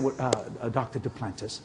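mock hushed awe, soft and low. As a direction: whisper the name like it's sacred money talk. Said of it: "they get real quiet when they start talking money".

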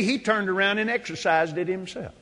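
normal talking voice

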